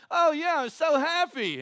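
mock a fake-smiling churchgoer, sing-song and slow; fake happiness. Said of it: "they're like".

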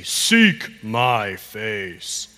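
very slow, low, each word set apart; slow modelling. Shown as a demonstration. Pastor showing a child the line, very slowly.

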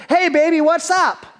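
put-on high, loud, jaunty hey baby; cocky greeting. Briar Rabbit sweet-talking the tar baby, high jaunty call. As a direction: strut up and call out to the doll.

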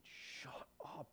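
stunned whispered shut up to a friend; disbelieving whisper. Retold or imitated. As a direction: hush it out like you can't believe it.

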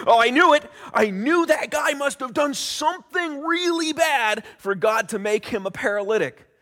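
loud, high, mock-triumphant outburst; smug onlooker. Bystander gloating about the paralytic's sin, loud mock shout. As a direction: ham up a know-it-all gloating shout. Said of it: "another guy said".

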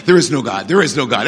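low, droning atheist chant; lightly voiced gloomy unbeliever, pitched low; a hint of a joyless naysayer; mock dour atheist. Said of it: "they gotta sit there and go".

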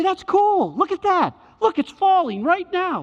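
high-pitched, acted-out wonder; excited stargazer. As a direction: exclaim as if pointing out a falling star. Acts out someone spotting falling star.

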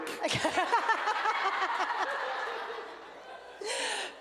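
high, long real laugh; burst of laughter. Real, not acted. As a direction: let the laugh spill out. Laughing at being in public.

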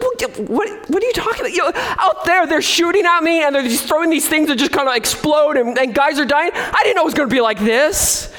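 high, mock-panicked complaining soldier; hammed-up grumbling, half laughing; whiny soldier. Soldier runs into the officer's tent complaining, high and whiny. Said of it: "started complaining".

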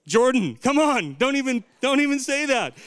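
loud, high playful protest; mock outrage. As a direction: argue it like an indignant sports fan. Mock sports argument with congregation.

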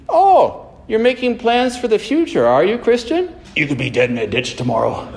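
mock surprise up high, dropping to a blunt verdict; blunt, tactless. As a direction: needle them in a high mock voice, then land it bluntly. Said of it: "he's a blunt, tactless fellow".

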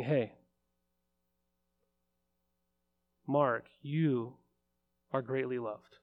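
soft, hushed words from an angel; tender angel's words. Pretend. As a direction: lean in and breathe it gently like a messenger. Angel speaks to a person.

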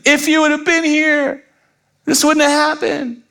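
overwrought, high-pitched wail; Mary. Acted. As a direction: drama queen's tearful reproach, very high. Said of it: "She just says it very differently".

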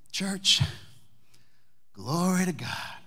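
lightly voiced old Southern man, slow and drawn out; a hint of an elderly churchman praising God, slowed down; Howard's praise. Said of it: "He was from the South".

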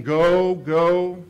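loud urgent go, go; inner urge to go. Retold or imitated. His impatient side urging him forward, loud and pressing. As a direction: push it out hard like an impatient urge. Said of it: "that part of me saying".

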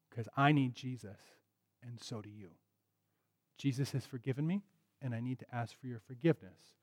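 soft, gentle admission; tender, humble plea; sincere apology. Acted. Parent telling child they both need Jesus, soft and sincere.

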